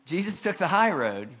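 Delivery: usual speaking voice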